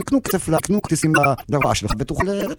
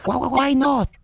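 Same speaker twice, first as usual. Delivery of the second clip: imitated shout; 13 semitones higher, slower than usual. Showing what someone shouts on a sampled record, high mock shout. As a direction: mimic someone crying out, voice jumping way up. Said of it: "מישהו שצועק".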